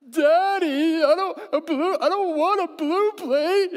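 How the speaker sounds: put-on high, loud, wailing little kid; preacher playing his whiny toddler; whining toddler. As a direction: mimic a crying child who won't have the blue plate, half laughing. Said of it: "it's like".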